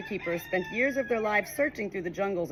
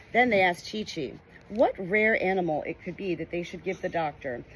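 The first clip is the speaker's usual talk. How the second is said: ordinary delivery, nothing marked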